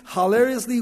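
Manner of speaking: ordinary talk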